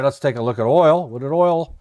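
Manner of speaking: ordinary talk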